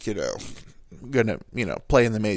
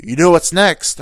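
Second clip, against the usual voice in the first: loud, higher imitation of Dad; dad's shouted quiz; 6 semitones higher, 8 dB louder than usual. Dad quizzing his eight-year-old on stops, shouted. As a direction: call the question out to a child. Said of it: "you keep shouting at me".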